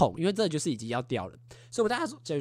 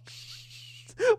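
breathy laugh. Real, not acted. Laughing at the memory.

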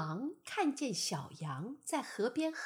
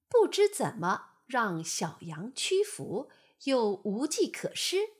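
plain reading voice

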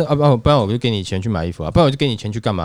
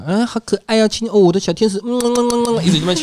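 doting dad; 10 semitones higher than usual. Retold or imitated. Dad doting on baby daughter.

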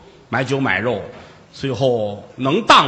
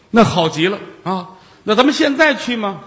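high, keen Taoist voice; eager Taoist; 8 semitones higher, 4 dB louder than usual. Acted. Taoist eagerly asking Jigong to go now, high voice. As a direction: jump at the offer, pitched up.

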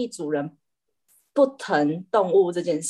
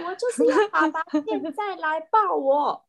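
high, wheedling pet voice; spoiled cat; 8 semitones higher than usual. Retold or imitated. The cat keeps insisting its dad come hold it, whining high. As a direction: whine like a pampered princess. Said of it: "他就说".

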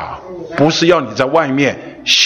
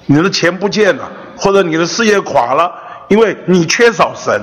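fervent preaching; 4 semitones higher than usual. Acted. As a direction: preach it with rising fire. Preacher builds to fervent climax.

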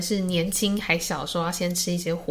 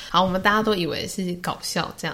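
usual speaking voice

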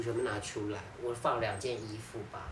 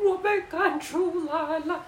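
high, slow, sobbing plea; crying; 4 dB louder, much slower than usual. Retold or imitated. Child crying at the neighbor's door, slow high sob. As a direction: cry it out like a kicked-out kid. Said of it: "他就大哭".

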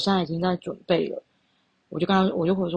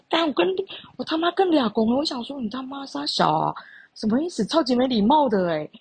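high, indignant swearing at his excuse; outraged; 7 semitones higher than usual. Retold or imitated. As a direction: fire off the curses in disbelief. Outraged inner reaction to his message. (愤怒地骂出来)

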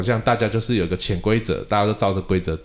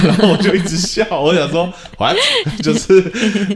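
laughing; 10 semitones higher, 7 dB louder, slower than usual. Real, not acted. Teacher laughing at the memory.

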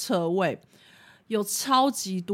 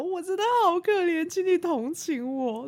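mock pitiful plea; 11 semitones higher than usual. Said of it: "我就直接跟那个人抱怨".

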